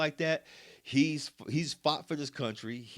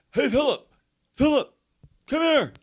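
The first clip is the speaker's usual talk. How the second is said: put-on loud, high-pitched holler of a deaf old man; bellowed summons, imitating an old veteran; yelling; 11 dB louder than usual. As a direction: call out like someone who can't hear himself. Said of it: "why are you yelling".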